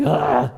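throaty mock groan of a reluctant kid; kid's grudging groan. Preacher mimics kid asked to do the dishes, grudging groan. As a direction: imitate a put-upon "ugh". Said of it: "sound that comes out of your kid's mouth".

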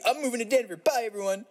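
high, cheery farewell; mock announcement. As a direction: announce it like a big deal. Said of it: "I feel like such an idiot".

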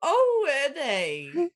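high, drawn-out mock-Australian; slow, lilting Australian put-on; bad Australian accent. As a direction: stretch it out in a fake Aussie accent. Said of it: "You guys are bad at accents".

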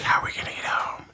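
fast, soft whisper; the high girlfriend whispering fearfully, fast. Retold or imitated. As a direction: whisper it quickly like a scared girlfriend. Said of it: "she's like".

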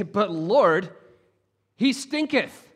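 playful olde-worlde reading of stinketh; mock King James. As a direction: ham up the archaic Bible line for a laugh. Comic reading of the King James line.

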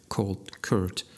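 ordinary delivery, nothing marked

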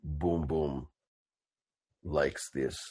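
deep, quiet brute speaking of himself; hushed brutish third-person rumble; low Boom Boom voice. Acted. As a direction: rumble it low like a gentle giant.

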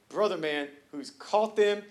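ordinary delivery

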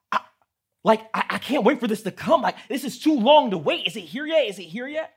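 put-on a child at the end of his rope; frustrated shout. Shown as a demonstration. Gerald tired of waiting, high frustrated shout. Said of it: "then he gets angry".

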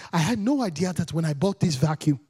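put-on grave African-accented mum, measured delivery; African mother's accent. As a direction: imitate an African mother solemnly explaining. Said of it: "She's like".

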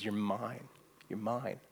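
a father tenderly claiming his lost son. Retold or imitated. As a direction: hold him close and whisper it kindly.

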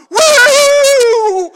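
very high, loud imitation whoop; wild whoop. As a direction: mimic a madwoman's wild shrieking whoop. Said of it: "Norma's going".

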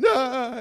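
a sudden high yelp of pain; retold yelp of pain. Son yelps as father moves his broken arm, high sharp yelp. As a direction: yelp like a boy whose broken arm is moved. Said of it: "that kind of a thing".

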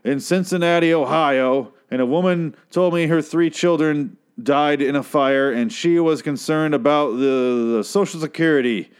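slow, measured stump-speech delivery; politician. Acted. Politician telling a made-up story of a worried mother, slow oratory. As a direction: spin a campaign anecdote, weighing every phrase. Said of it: "sounds like a politician made up story".

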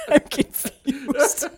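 slow, high mock whimper; tearful grandpa. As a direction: imitate a weeping, bewildered grandpa through laughter.

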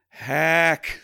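loud, high one-word verdict; Buck. Retold or imitated. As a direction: call it out in a high, punchy shout. Buck's blunt verdict acted out.

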